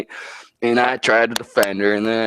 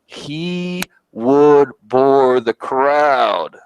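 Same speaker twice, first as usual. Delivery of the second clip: slow mock drone; 2 semitones higher, 4 dB louder, much slower than usual. Mocking boring opponent slowly.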